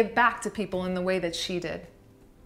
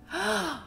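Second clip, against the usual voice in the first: a high, sharp little gasp of wonder; imitated delighted gasp; 10 semitones higher, 4 dB quieter than usual. Aunt mimicking her niece's mirror gasp, a high delighted gasp. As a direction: gasp like a toddler seeing herself.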